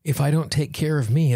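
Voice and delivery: ordinary talk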